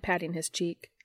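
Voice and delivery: ordinary delivery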